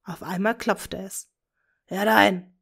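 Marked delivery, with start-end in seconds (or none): 1.8-2.6 a call through the door. Acted. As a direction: call them in. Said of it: "rief Tom". (ruft herein)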